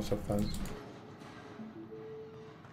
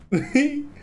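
nervous laugh; 12 semitones higher than usual. Real, not acted. Laughing after scare.

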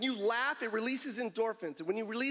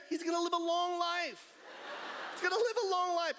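higher, excited proclamation; excited shout; 7 semitones higher than usual. Real, not acted. Hyping a laughing audience member.

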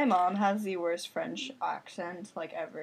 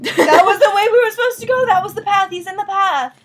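high, loud, laughing panic; panicked shout; 10 semitones higher, 15 dB louder, faster than usual. Real, not acted. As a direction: yell in frightened disbelief. Monster in path, panic.

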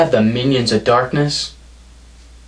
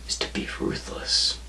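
menacing whisper; 14 dB quieter than usual. Acted. Wrestler taunting his rival Painkiller, sinister whisper. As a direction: drop to a whisper on ruthless.

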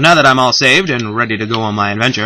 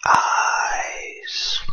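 hushed spooky whisper; eerie whisper; 7 dB quieter, much slower than usual. Pretend. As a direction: breathe it out creepily.

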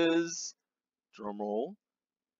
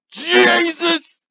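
exasperated shout; 13 semitones higher, 9 dB louder than usual. Acted. Exasperated reaction.

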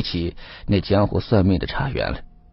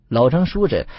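plain reading voice

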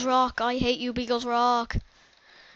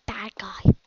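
whisper; 3 semitones lower, 3 dB louder than usual. Acted. Swallowing swear word in whisper.

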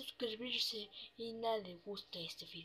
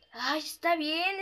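normal talking voice

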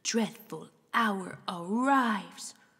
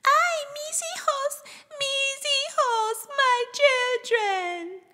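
very high, wailing cry; La Llorona wail; 4 dB louder than usual. Acted. The weeping woman crying for her children, high wail.

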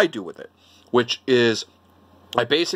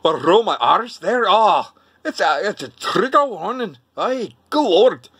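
high, swooping mock Glaswegian; Glasgow accent; 5 semitones higher than usual. Asked if they speak Glasgow, hammed-up Glaswegian. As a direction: put on a hammed-up Scottish brogue with rolled R's. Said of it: "do you speak Glasgow".

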